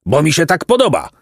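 mildly neighed, high-pitched gloating; neighing monster. A narrator's reading. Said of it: "zarżał potwór". (lekko rżący głos potwora)

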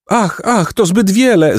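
high, mock-weeping maidens; theatrical weepy protest; tearful cry. A narrator's reading. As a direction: lament in a high, pleading voice. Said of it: "zapłakały dziewice".

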